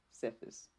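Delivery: Cephas said quietly as SEF-iss; tentative pronunciation. Shown as a demonstration. Settling on how to say the name, Cephas as SEF-iss. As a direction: try out Cephas with a short e. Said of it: "I think that's how you say it".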